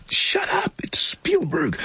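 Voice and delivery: high, frantic hushed warning; panicked shush; mock urgent warning.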